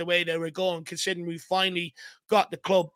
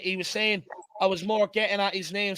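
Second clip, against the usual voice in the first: normal talking voice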